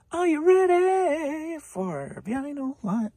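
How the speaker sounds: slow, big game-show announcement; announcer catchphrase. Pretend. Reseller hyping his next lot, booming game-show call.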